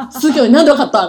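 loud, laughing amazement; amazed laugh. Real, not acted. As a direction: laugh it out in disbelief. Retelling boyfriend's surprising catch. (笑いながら驚いて)

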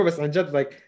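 usual speaking voice

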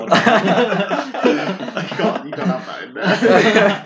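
loud, high, helpless laughing protest. Real, not acted. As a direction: protest loudly through real laughter. Protesting a joke while laughing.

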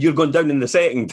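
loud boastful fight taunt; a brash Ali-style boast; Muhammad Ali taunt. Retold or imitated. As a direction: taunt the opponent like Ali before a bout. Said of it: "He used to say".

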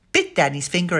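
plain reading voice

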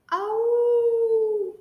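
high howling wolf cry. Acted. Kids matching animal sounds.

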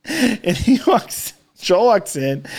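ordinary talk, nothing marked

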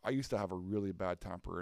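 usual speaking voice

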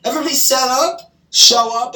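high, loud, indignant exclamations; worked-up outburst. Real, not acted. To a sibling, realizing the family got played, worked-up outburst.

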